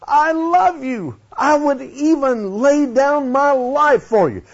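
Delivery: a lightly voiced Peter swearing devotion; voicing Peter. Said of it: "He said".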